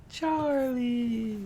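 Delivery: high, drawn-out cartoon call; sing-song high unicorn voice; Charlie cartoon impression. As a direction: stretch the name out in a high cartoon voice. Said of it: "is that the Charlie one?".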